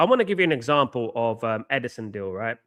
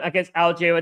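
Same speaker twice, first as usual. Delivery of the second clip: ordinary talk, nothing marked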